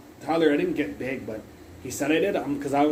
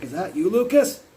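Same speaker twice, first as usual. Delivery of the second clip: retold shout; 6 semitones higher, 6 dB louder than usual. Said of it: "I screamed it".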